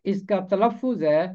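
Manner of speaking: ordinary talk, nothing marked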